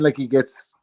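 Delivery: normal talking voice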